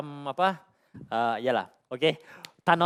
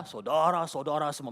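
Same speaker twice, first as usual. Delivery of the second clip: BM church preacher's intonation; 4 dB quieter than usual. Shown as a demonstration. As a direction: stretch the vowels like a church preacher. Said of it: "intonasi saya".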